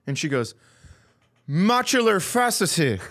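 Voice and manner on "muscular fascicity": "Muscular fascicity" higher, with the second word mangled; worsening garble. Retold or imitated. Cashier failing a third time, "fascicity" garbled and high-pitched. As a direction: blurt a botched "fascicity" for dystrophy. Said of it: "And it's getting worse".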